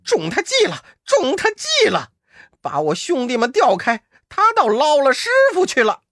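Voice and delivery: 声音拔高，又气又急; 跺脚大喊中计了; 气得暴跳. Acted. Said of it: "气得暴跳道". (暴怒地喊)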